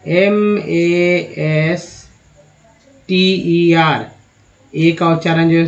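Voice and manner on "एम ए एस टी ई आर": master spelled letter by letter, very slowly; slow spelling. Shown as a demonstration. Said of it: "आ वाला देखते हैं".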